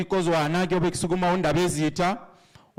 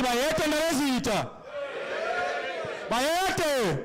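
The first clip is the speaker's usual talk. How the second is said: royal salute call; 9 semitones higher, 3 dB quieter, slower than usual. Acted.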